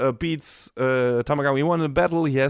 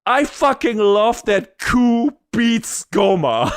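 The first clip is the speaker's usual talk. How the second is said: normal talking voice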